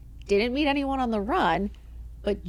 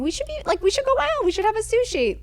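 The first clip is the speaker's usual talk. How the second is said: much higher, bubbly mock friend voice; imitating sister-in-law; 12 semitones higher, 3 dB louder than usual. Future sister-in-law texting an invite, high bubbly imitation. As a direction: chirp it like an eager girlfriend inviting you. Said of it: "She starts texting saying".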